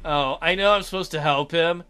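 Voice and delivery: ordinary delivery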